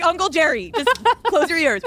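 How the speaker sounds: mock plea. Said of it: "you're like".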